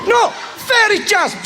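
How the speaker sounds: high slow shout; shouted name. Shown as a demonstration. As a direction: call out the name high and drawn out. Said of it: "I shout that at you".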